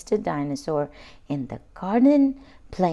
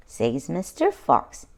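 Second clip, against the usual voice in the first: usual speaking voice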